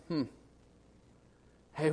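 ordinary talk

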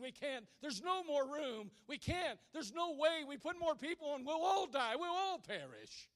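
refusing. Acted. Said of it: "And they said".

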